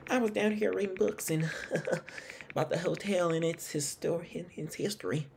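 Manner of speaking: high Southern character voice with a small laugh; Lauren. Acted. Suspect explaining what she was reading, in a high Southern accent. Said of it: "it's hard to do a accent with her".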